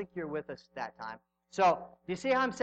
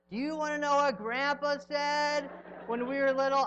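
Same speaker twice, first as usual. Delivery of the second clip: high-pitched mock child retelling family lore; imagined excited grandchild; 9 semitones higher, 3 dB louder than usual. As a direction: pitch it up like a kid sharing a story. Imagines grandchildren passing down story.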